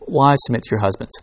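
"Wives, submit to your husbands" said quietly in passing; unemphasized. Shown as a demonstration. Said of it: "They put the emphasis on a completely different syllable".

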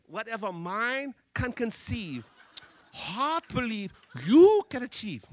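lightly imitated Chinese accent, halting; broken English. Speaker imitates a Chinese student's broken English. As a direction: mimic the boy's broken English slowly. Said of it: "speaks broken English".

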